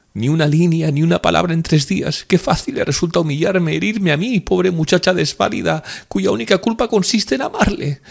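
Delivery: very high, distraught woman's voice; high-pitched tearful reproach; anguished lament. A narrator's reading. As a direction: pour out the hurt, wounded and pleading. Sobbing woman laments being abandoned.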